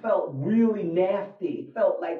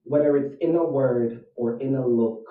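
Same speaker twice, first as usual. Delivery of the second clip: poem recitation; 7 semitones lower than usual. Acted. Reciting the Babadook poem for a challenge, ominous. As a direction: recite a creepy rhyme low and measured.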